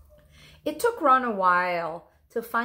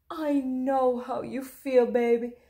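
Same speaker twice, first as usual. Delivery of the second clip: tender mother; 4 semitones higher, 3 dB louder than usual. Acted. Mother comforts son.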